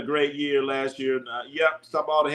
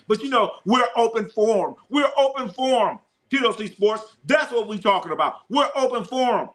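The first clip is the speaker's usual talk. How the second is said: excited hype; 8 semitones higher than usual. Real, not acted. Hyping the open forum.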